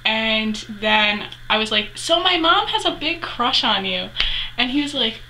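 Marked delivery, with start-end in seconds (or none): 1.9-4.1 high-pitched retold teasing; playful confession. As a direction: imitate coyly telling on mom in a high voice. Retells teasing confession to idol.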